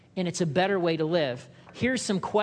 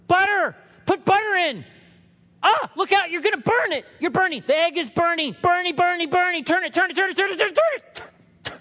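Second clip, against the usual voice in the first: much higher, loud frantic shouting; panicked yelling husband; 13 semitones higher, 7 dB louder than usual. Retold or imitated. Husband shrieking orders at wife frying eggs. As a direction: yell frantically as the egg burns. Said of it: "and he says to her".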